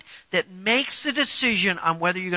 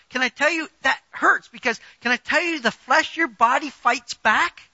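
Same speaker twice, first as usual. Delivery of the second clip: normal talking voice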